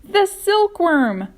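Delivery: high, loud and drawn-out cry; cried out. A narrator's reading. Grasshopper cries the answer out to James, loud and high. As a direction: announce the silkworm like a triumphant discovery. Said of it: "cried the old green grasshopper".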